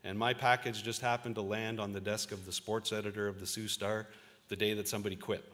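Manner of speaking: ordinary delivery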